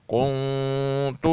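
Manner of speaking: کنت slowly, nun hidden in the nose; nasal ikhfa. Shown as a demonstration. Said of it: "اس پہ اخفا کیا جائے گا". (کنت میں اخفا، لمبی ناک کی آواز)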